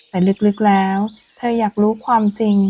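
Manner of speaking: usual speaking voice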